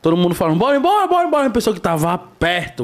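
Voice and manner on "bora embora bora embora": higher-pitched mimicked hurry-up; urgent retold call. Retelling a near-miss to the hosts, urgent mimicked call. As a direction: imitate someone urging everyone off. Retold shouts of someone nearby.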